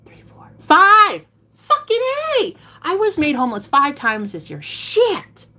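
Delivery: high-pitched shout of disbelief; outraged realization. Real, not acted. Streamer realizing she was homeless five times, outraged disbelief. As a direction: count up and explode in indignation. Realizes a fifth eviction while counting.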